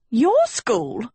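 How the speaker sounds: high shriek on "Your school?", YOUR stressed; shrill indignant cry. A narrator's reading. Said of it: "Sophie shrieked so loudly".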